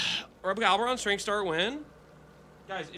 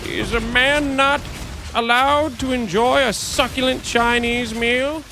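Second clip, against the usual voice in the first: higher, slow, offended meme voice; meme quote; 6 semitones higher, slower than usual. Retold or imitated. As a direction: quote the succulent Chinese meal man with mock outrage. Quoting the Chinese meal meme.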